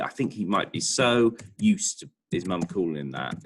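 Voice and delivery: usual speaking voice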